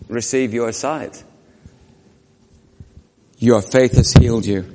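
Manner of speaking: gentle pronouncement. Acted. Jesus voiced healing.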